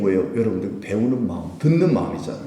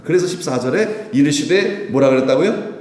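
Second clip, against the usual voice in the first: usual speaking voice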